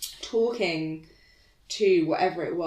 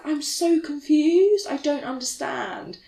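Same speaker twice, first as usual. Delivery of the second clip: panicked; 8 semitones higher, 3 dB louder, slower than usual. Retold or imitated. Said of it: "I was like".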